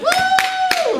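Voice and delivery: loud, high demo whoop; crowd cheer. Shown as a demonstration. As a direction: whoop to get the audience cheering. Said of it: "hoot and holler and yell".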